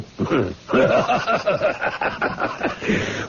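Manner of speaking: knowing, scheming chuckle; sly villain's laugh. Acted. Doctor Kirk gloating to Wolfe over the new recruit, sly laugh. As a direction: laugh like you've got him hooked.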